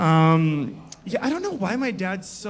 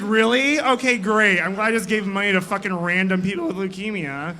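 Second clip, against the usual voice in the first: mock outraged sister; 3 semitones higher, 6 dB louder than usual. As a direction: spit out the sarcasm at the wasted money. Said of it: "she's like".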